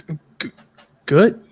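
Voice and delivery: stuttering on the word; tripping over the start. Real, not acted. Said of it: "I got a little stutter".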